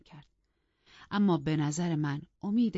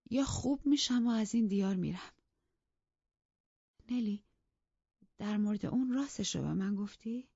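higher, agitated, bitter invalid voice; feverish distressed mistress; 6 semitones higher than usual. A narrator's reading. Catherine raving to her maid about her husband, agitated. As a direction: rave in wounded pride and despair.

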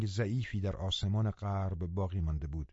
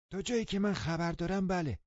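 put-on an old housekeeper, high and thin; old woman's voice; 9 semitones higher than usual. A narrator's reading. Old woman answers again. (صدای زیر و آرام پیرزن)

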